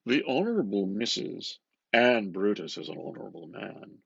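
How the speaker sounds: ordinary talk, nothing marked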